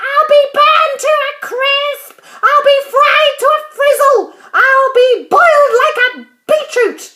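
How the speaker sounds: high, loud, frantic wailing yell; Grandma yelling. A narrator's reading. Grandma fearing she'll burn to a crisp, frantic yelling. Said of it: "she yelled".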